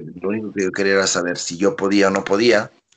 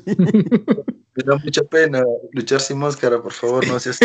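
mock pleading; 6 semitones higher, 3 dB louder, slower than usual. Begging to keep mask.

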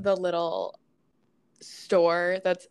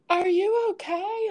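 worried onlookers; 12 semitones higher, 3 dB louder than usual. Retold or imitated. Everyone asking if she's okay.

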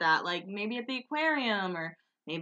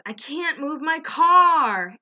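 higher, louder and slow whine; imitating Kristen; 7 semitones higher, 9 dB louder, slower than usual. Kristen telling the cop she can't move her car, slow whine. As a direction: drag it out high and loud. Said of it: "and she goes".

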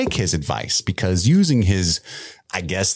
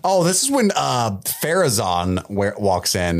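normal talking voice

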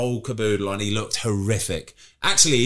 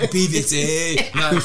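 mock Scottish accent; 3 semitones higher than usual. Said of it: "that was Scottish, by the way".

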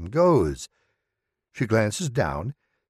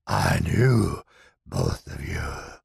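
low, slow, whispery shadow creature; menacing low spirit voice; dark entity voice; 5 semitones lower, slower than usual. Acted. The entity speaking.

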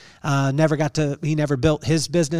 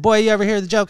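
put-on higher and louder, a dad's teasing call of boy; imitating his dad; 6 semitones higher, 4 dB louder, faster than usual. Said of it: "He called me boy".

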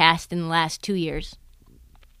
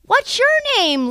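put-on high, sweet-talking question to a little kid; high-pitched kid-directed voice; talking to a child; 14 semitones higher than usual. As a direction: ask a small child, pitched way up. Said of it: "And I'm like".

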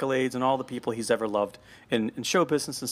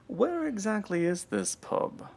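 put-on prim old lady voice; 6 semitones higher, 4 dB quieter, slower than usual. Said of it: "Missus Slocum says that".

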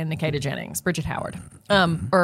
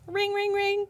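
high sung ring ring; mock phone ring; 12 semitones higher, 4 dB quieter, slower than usual. Host imitating a phone call ringing, high chirpy ring.

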